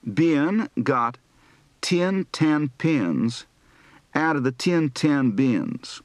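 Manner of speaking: slow Southern accent, "ten" and "tin" merged; Southern drill, "pins" and "pens" sound alike. Shown as a demonstration. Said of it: "Southern accent practice for the I E shift".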